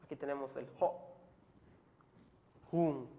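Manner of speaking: ordinary talk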